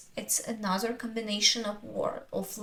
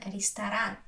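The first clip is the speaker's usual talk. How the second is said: usual speaking voice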